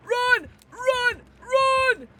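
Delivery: very high, loud repeated yells; frantic shouting. Real, not acted. Player urging escape in the game, frantic shouting. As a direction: yell it like an alarm.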